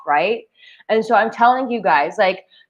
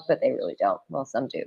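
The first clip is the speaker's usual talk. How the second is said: quiet aside; 9 semitones lower, 9 dB quieter, faster than usual. Real, not acted. Aside after joking.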